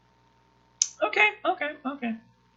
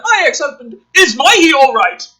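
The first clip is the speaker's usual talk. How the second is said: loud, much higher mock delivery; outraged mock reread; 12 semitones higher, 9 dB louder than usual. Rereading the script's line to her cohost, loud mock outrage. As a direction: hammer the silly line with disbelief.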